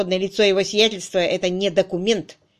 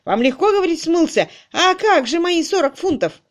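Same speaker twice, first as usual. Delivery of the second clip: loud, raised, aggrieved protest; angry; 9 semitones higher, 6 dB louder than usual. A narrator's reading. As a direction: protest angrily over your money. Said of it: "рассердился Крачли".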